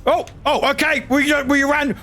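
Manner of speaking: ordinary delivery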